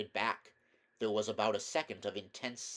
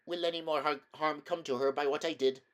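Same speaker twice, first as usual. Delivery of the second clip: put-on higher, worried girl's voice; anxious question; 5 semitones higher, 3 dB louder than usual. A narrator's reading. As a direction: ask timidly, fearing harm to another. Aravis anxiously questions the lion.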